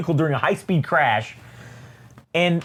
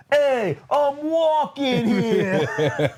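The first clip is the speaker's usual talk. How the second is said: high, very drawn-out mock holler; comic calling out; 10 semitones higher, much slower than usual. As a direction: stretch each word slowly in a high cartoon voice. Stilt Man shouts down, acted.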